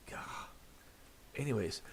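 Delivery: usual speaking voice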